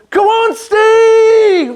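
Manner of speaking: very high, loud, slow stretched shout; crowd cheer. Retold or imitated. Crowd cheering Steve at the final corner, long high shout. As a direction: call his name across the stadium. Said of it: "as I hear my name being shouted".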